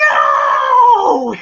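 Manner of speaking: long, drawn-out, high mock cry; slow-motion yell. Tony Duke watching Rocky punched, slow-motion yell. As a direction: bellow it like a film's slow-motion moment. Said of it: "And the shit's in slow motion".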